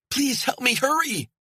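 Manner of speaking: high, loud frantic plea; panicked shouts to the approaching stranger; desperate calling. Acted. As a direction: call out for rescue, urgent. Woman frantically calls a distant hiker.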